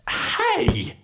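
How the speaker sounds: high, loud, fast outraged yelp; indignant yell. Acted. Villain yelling at the human who shoved her. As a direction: snap back in shock at being shoved.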